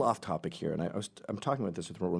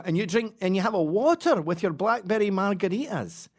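higher, louder Scottish voice; Scottish tourist; 10 semitones higher, 5 dB louder than usual. Pretend. To the margarita guys, Scottish accent. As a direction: keep the fake Scot going. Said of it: "And they go".

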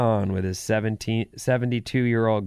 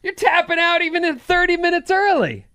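laughing disbelief; 5 dB louder than usual. Real, not acted.

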